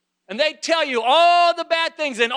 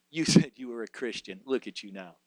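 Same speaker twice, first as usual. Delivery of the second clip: mocking taunter; 14 semitones lower, 9 dB quieter than usual. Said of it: "those tormentors, those taunters".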